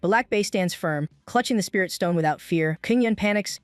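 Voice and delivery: ordinary delivery, nothing marked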